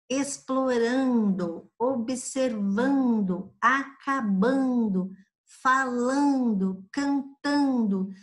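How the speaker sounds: slow, evenly spaced list of "-ando" words; measured teacher's dictation; drawn-out gerund examples. Shown as a demonstration. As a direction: stretch each word through to its "-ando" ending. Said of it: "formado pelo sufixo".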